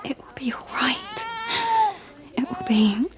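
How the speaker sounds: soft whisper, rising at the end; whispered comfort. Acted. Mother whispering to calm her baby, soft and reassuring. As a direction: breathe it to the baby.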